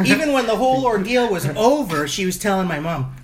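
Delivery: normal talking voice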